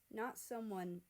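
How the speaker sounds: usual speaking voice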